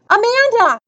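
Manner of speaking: high, urgent call of a name. Acted. Boy calling after the running girl, urgent. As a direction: call after her, pitch well up.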